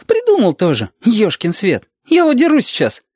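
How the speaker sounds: put-on high, indignant child voice; rebellious child. Reshka threatening to run away, rebellious child voice.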